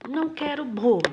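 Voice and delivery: "Não" batido alto, voz mais aguda e mais forte; recusa firme. Shown as a demonstration. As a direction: diga "Não quero bolo" recusando com força. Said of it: "Presta atenção nessa segunda frase". ("Não" firme e alto, recusa decidida)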